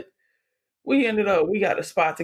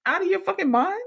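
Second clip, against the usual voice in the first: high-pitched mock outrage; incredulous retort; 12 semitones higher, much faster than usual. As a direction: act out disbelief, pitch way up. Acted retort to returning ex.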